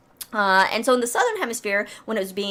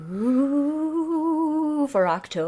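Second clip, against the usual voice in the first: mock spooky Halloween voice; drawn-out eerie ooh to spook listeners; 6 semitones higher, much slower than usual. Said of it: "Oh, that's pretty good".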